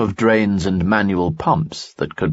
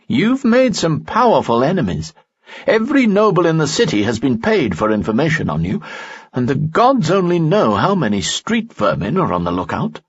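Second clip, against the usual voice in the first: higher, urgent warning; Lanson warning, higher; 9 semitones higher than usual. A narrator's reading. Friend warns Lee of his enemies, higher urgent voice. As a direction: warn him of his enemies, a bit fast.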